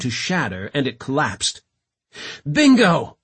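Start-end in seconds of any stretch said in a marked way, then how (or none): 2.4-3.2 loud, high exclamation; triumphant shout. A narrator's reading. London after downing a leaper, shouting. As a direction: shout it as your shot lands. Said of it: "he shouted".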